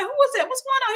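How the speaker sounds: very high mock panic; alarmed questioning. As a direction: re-enact bewildered alarm in a high voice. Retelling her alarmed questions mid-chase.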